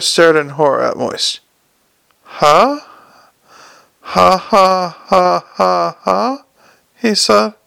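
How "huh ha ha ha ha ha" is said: acted mad laugh, starting as a questioning yelp; spaced-out, mechanical ha-ha laughter; hysterical forced laugh. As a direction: laugh in slow separate bursts. Hubert breaks into hysterical laughing.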